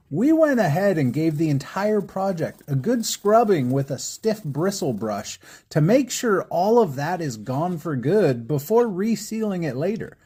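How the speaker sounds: ordinary talk, nothing marked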